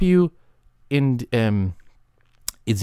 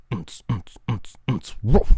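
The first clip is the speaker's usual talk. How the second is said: beatbox; 4 semitones lower, slower than usual. Shown as a demonstration. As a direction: mouth percussion ending in a short woof. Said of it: "That is good".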